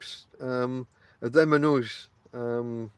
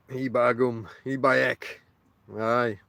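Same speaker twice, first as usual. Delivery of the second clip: hammed-up Yorkshire dialect aside; lower, playful broad Yorkshire; mock Yorkshire; 4 semitones lower, slower than usual. Vlogger sending up his northern roots, mock Yorkshire.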